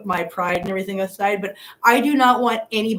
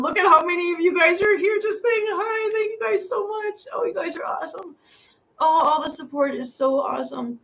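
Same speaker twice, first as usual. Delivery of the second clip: excited thanks; 8 semitones higher than usual. Real, not acted. Overwhelmed thanking many stream viewers.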